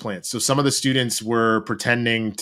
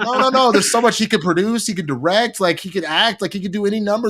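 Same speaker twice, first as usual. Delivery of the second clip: mom's reply; 10 semitones higher, 3 dB louder than usual. Retold or imitated. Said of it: "She was like".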